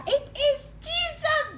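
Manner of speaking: high, slow excited call; thrilled shout of recognition; joyful holler. Acted. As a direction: cry out in delighted recognition. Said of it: "they holler".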